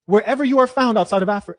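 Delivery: normal talking voice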